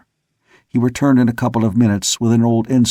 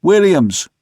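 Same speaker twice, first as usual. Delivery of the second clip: high, loud call of a name; raised carrying hail; 9 semitones higher, 5 dB louder than usual. A narrator's reading. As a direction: holler for a man aloft. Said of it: "more loudly".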